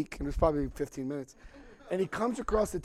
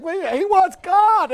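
slow, high, loud mock attendant; a lightly voiced stunned attendant; mock flight attendant; 14 semitones higher, 10 dB louder, much slower than usual.

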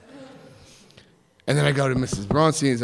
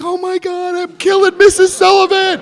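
high, loud panic; high loud panicked thought; 9 dB louder than usual. Retold or imitated. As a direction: shriek it in horror.

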